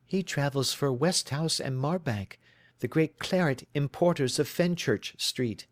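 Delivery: a worried bride telling her story; anxious young woman. Acted.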